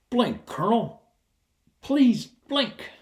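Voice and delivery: comic high plea to a staring colonel; pleading inner voice. Retold or imitated. Silently begging colonel to blink.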